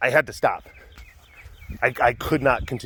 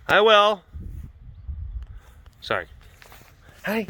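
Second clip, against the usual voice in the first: ordinary talk, nothing marked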